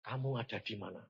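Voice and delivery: soft, low voice of God; quiet divine question. Retold or imitated. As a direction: ask it low and hushed. God's question, asked first gently.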